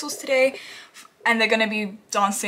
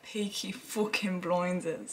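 slow, lower Birmingham gangster growl; Peaky Blinders accent; 3 semitones lower, 9 dB quieter, much slower than usual. Pretend. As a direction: drag out each word like a Birmingham gang boss. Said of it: "like Peaky Blinders".

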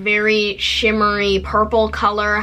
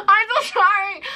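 mock whiny apology; 8 semitones higher than usual. Apologising to her aunt.

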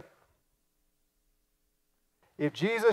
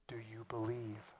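soft whispered question; 6 semitones lower, 21 dB quieter than usual. Real, not acted. Preacher asking the congregation if they believe, whispered. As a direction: ask it close and hushed.

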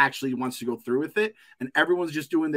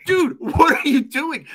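mock exasperation; 10 semitones higher, 6 dB louder, slower than usual. Mocking the character's slip.

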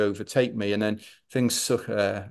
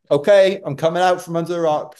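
high, loud sudden agreement; eager giving in; 8 semitones higher, 7 dB louder than usual. Retold or imitated. Runner tempted out from the rock by cookies, eager high call. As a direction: call out brightly, won over at once.

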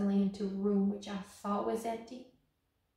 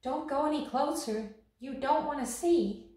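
higher, raised voice; bystander's urgent warning, higher than usual; 5 semitones higher, 5 dB louder than usual. Acted. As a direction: warn someone back, pitched up and a touch louder. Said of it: "somebody caught my arm".

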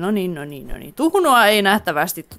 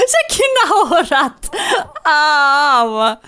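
laughing exclamation; 3 semitones higher, much slower than usual. Real, not acted. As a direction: squeal it while cracking up. Laughing along with the baby.

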